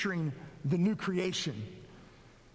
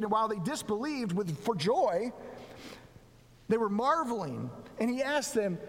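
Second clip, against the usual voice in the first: raised storytelling read of the gospel; scripture reading; 6 semitones higher than usual. Acted. Pastor reading Luke's commission to the church, lifted scripture reading. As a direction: read Jesus' words with lifted, earnest voice. Reads Luke passage aloud.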